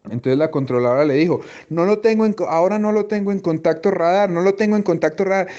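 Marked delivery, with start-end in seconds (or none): 1.7-5.5 controller losing contact. Retold or imitated.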